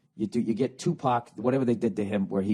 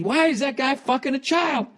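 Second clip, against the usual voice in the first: screaming; 13 semitones higher, 5 dB louder than usual. Pretend.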